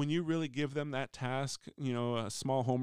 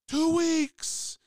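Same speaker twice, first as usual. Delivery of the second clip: much higher, louder triumphant cry; grinning shout; 9 dB louder than usual. Retold or imitated. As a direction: blurt it out with a huge grin. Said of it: "biggest shit-eating grin".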